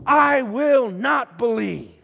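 high, loud, slow refusal; stamping emphasis. A narrator's reading. Thomas refuses the disciples' news, stampingly emphatic. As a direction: hammer each word, slowly and loudly. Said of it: "adding with emphasis, perhaps even stomping his feet".

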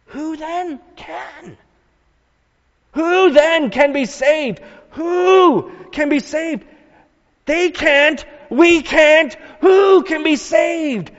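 high pleading cry building to a shout; preacher voices the disciples' despair, rising to a high shout; hopeless outcry. Acted. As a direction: act out despair, rising to yelling.

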